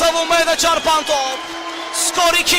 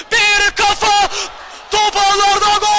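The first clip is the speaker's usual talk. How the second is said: excited goal call; 4 semitones higher than usual. Real, not acted.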